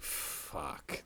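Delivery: breathy whispered despair, drawn out slowly; hushed dread. Real, not acted. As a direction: let it out as a slow, hopeless whisper. Dreading a fan encounter.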